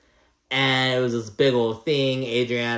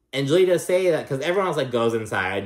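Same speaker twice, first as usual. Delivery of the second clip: ordinary talk, nothing marked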